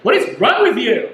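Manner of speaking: high, loud mock outrage; rude complaint. Diner berating restaurant staff over a wrong order, mock outraged. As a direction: play an over-the-top angry diner.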